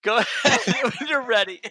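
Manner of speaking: laughing through the words; giggling while talking. Real, not acted. Cracking up after the silly answer.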